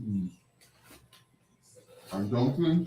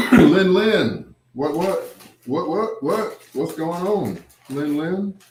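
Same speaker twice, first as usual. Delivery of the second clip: excited greeting; 6 semitones higher, 5 dB louder, slower than usual. Real, not acted. Greeting friend arriving.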